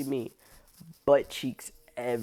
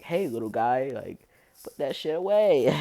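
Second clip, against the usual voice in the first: louder, higher telling-off; telling the flasher off, louder and higher; mock scolding; 3 semitones higher, 7 dB louder than usual. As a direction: scold him like a naughty kid.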